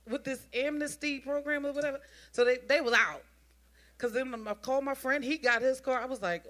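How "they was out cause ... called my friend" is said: normal talking voice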